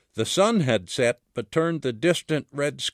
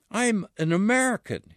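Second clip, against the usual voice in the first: higher, gently begging, a mild plea; pleading; 7 semitones higher than usual. Acted. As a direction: ask to be believed, understated pleading. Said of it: "pleading now".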